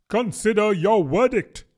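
a pompous little king; a touch of a royal command. Acted. The king to the jury.

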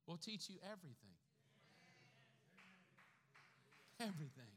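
quiet awe; hushed. Real, not acted. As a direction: let it land in a near hush. Hushed repeat of the verse.

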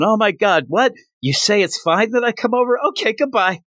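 high, excited squeal down the phone; mock shrieking phone call; screaming excitedly. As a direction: squeal it like a giddy girl. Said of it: "but she's screaming".

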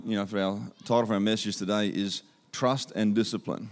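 ordinary talk

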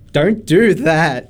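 slow, drawn-out mock plea; pleading protest. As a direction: stretch each word as if begging him to stop. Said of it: "And I'm just like".

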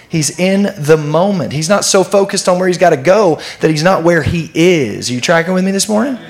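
ordinary talk, nothing marked

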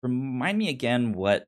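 normal talking voice

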